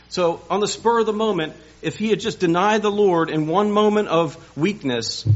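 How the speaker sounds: high, loud pulpit preaching; preaching at full force. Acted. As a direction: drive the point home to the congregation.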